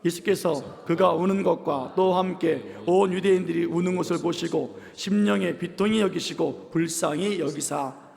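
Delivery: slow, measured, chant-like reading; slow, evenly paced unison reading; congregational scripture reading. Acted. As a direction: lead the congregation through the verse steadily. Leads congregation reading scripture aloud.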